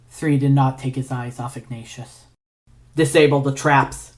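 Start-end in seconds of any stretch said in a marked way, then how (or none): 2.9-4.1 firm, louder command; demanding. Acted. Three ordering Ignatius at sword point, louder demand. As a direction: order it, no room for argument. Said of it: "he demanded".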